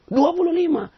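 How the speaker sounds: stubborn man refusing the correct answer; stubborn insistence. Retold or imitated. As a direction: insist like a mulish child.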